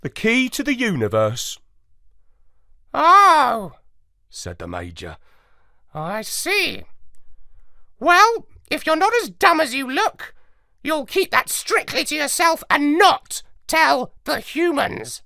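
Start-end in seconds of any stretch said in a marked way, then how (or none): 0.0-1.6 grave. A narrator's reading. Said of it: "I said gravely". 2.9-3.7 high, loud parrot squawk; a surprised squawk like a parrot. A narrator's reading. The parrot Major speaks. 5.9-15.2 high, louder parrot voice, sneering; scornful parrot. A narrator's reading. The parrot Major warns.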